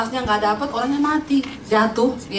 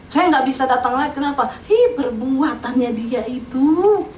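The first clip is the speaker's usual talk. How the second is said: drawn-out, hammed-up grudge; mock a neighbour's bitterness, stretching the last words; bitter neighbour. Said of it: "masih kepahitan sama mayat".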